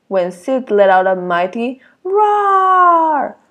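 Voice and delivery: slow, drawn-out build to roar; dramatic roar. A narrator's reading. Reading to children, slowing down dramatically as Sid roars again. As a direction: lean on the word roar, slowly.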